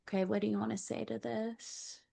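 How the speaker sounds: ordinary talk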